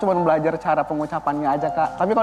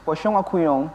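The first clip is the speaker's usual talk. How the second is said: Indonesian ghost names said the French way; Frenchify the words; French accent. Shown as a demonstration. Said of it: "Logat Perancisnya?".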